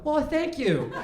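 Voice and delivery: cheerfully oblivious thanks; walking by, thanking the man who insulted him; mock polite. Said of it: "they hear me go".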